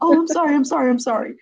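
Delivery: high-pitched, hurried mock apology; apologetic son. Son interrupting mom's phone meeting, retold as flustered apology. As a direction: imitate a kid caught interrupting, sorry over and over.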